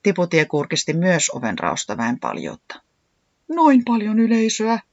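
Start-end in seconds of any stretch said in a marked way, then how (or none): 3.5-4.8 small, high-pitched alarmed voice; frightened. A narrator's reading. Tiputi peeks at the crowd, frightened. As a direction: gasp it out in dread. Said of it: "nokka alkoi kalista pelestyksestä".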